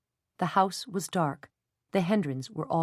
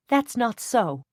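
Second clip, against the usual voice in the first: higher and louder, sharp outburst; angry cry; 6 semitones higher, 5 dB louder, slower than usual. A narrator's reading. Jenny cutting Sarah off, angry cry. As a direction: cut her off hard. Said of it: "Jenny cried angrily".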